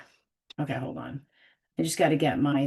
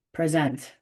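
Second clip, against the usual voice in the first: normal talking voice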